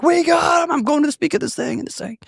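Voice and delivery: high, exuberant re-enacted holler; excited yelling. Husband yelling his good news to his wife, high and excited. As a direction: blurt out great news in a high cry. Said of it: "What are you yelling about?".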